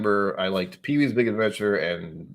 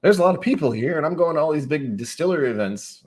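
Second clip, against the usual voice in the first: mock excited shout; 7 semitones higher, 3 dB louder than usual. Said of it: "being like".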